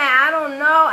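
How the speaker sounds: high, loud playing dumb; exaggerated "no idea" protest; acting dusty. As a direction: imitate a kid feigning ignorance. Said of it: "acting dusted as I can act".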